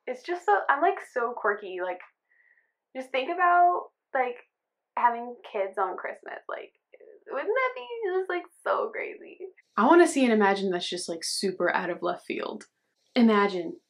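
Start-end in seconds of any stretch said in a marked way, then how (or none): 0.1-9.4 high, breathy airheaded whisper; quirky girl voice. Pretend. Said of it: "for this girl to just be like".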